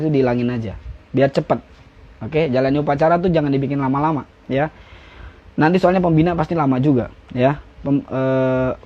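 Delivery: ordinary talk, nothing marked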